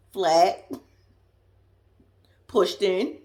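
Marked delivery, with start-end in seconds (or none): 0.0-0.7 laughing. Real, not acted. Mocking a friend's flat butt, laughing hard. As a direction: cackle through the word Flat.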